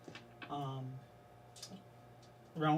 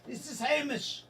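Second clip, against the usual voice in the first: put-on Scottish accent; 6 semitones higher than usual. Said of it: "I can't get rid of this motherfucking accent".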